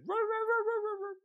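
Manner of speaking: loud, high howled "roar" sounds; loud dog howl. Retold or imitated. Storyteller imitating the dog howling loudly in the backyard. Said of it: "And now it's loud".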